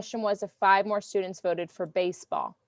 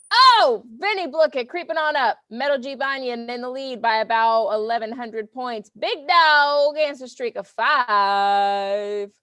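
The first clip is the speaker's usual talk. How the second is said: higher, louder sportscaster hype; leaderboard hype; 4 semitones higher, 6 dB louder than usual. Real, not acted. Teacher announcing the leaderboard to the class, excited and loud. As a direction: call it like a big game announcer.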